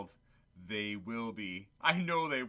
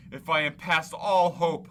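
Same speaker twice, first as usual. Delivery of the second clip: anguished despair; 4 semitones higher, 10 dB louder than usual. Acted. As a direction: cry out in hopeless anguish. Scrooge despairs before the spirit.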